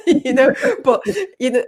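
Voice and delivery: loud high burst of laughter into words; laughing hard at her own story; real laughter. Real, not acted. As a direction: laugh out loud, then keep talking through it. Laughs at imitated husband.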